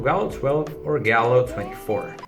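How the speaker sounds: ordinary talk, nothing marked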